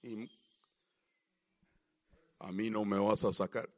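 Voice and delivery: deep male-sounding demon voice; demonio con voz de hombre. Acted. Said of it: "hablan como hombre". (voz grave de demonio, como de hombre)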